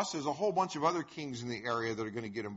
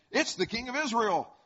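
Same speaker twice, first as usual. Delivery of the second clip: excited exclamation; 10 semitones higher, 5 dB louder than usual. A narrator's reading. Captains spot the supposed king, reading.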